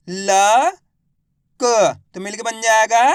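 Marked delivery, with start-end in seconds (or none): 0.1-1.6 ल, क each sounded alone with a pause between; letter by letter. Shown as a demonstration. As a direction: spell out ल, क as separate sounds. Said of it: "किस तरीके से अपन इन शब्दों को". (ल, क को अलग-अलग रुककर बोलें)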